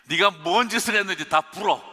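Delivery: loud, high mock demand to confess; barked interrogation. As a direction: bark it like a bullying interrogator. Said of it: "막 이렇게 하시는 분은 아니에요".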